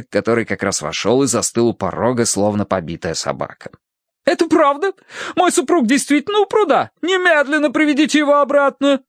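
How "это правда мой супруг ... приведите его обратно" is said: very high, fretful matron; alarmed demand. A narrator's reading. Wife orders the young man to fetch her husband, high-pitched alarm.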